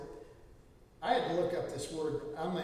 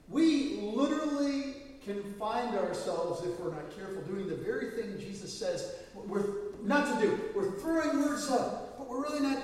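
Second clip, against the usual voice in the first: ordinary delivery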